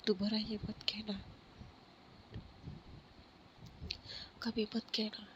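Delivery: soft whisper, pleading gently; whispered plea. Acted. Khushi begging Pari never to say it, whispered. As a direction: breathe it softly so only she hears. Tender plea to crying friend.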